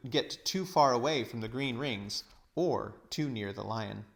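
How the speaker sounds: usual speaking voice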